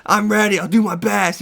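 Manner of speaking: imitating her fired-up battle cry; mock yell. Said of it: "she just keeps screaming".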